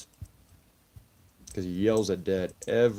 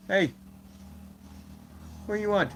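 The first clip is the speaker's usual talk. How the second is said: higher, sing-song talk to the cat; high pet voice; 8 semitones higher, faster than usual. Real, not acted. Streamer to cat on his desk, high pet voice.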